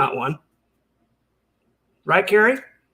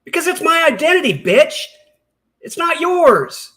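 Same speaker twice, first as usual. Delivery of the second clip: much higher, outraged yelling; furious outburst; 9 semitones higher, 4 dB louder than usual. Real, not acted. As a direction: blow up at her in disbelief. Furious reply to Carrie's question.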